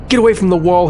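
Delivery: loud, stern, high command; stern order. Acted. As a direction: bark it as a firm warning. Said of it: "he ordered, voice stern".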